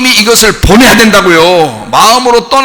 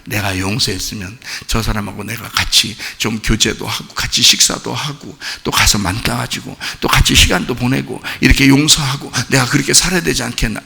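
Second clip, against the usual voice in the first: much lower and softer, pondering; musing to oneself; 10 semitones lower, 9 dB quieter than usual. Acted. Preacher voicing a believer's inner thoughts, low and quiet. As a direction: muse quietly under your breath. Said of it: "또 생각한다".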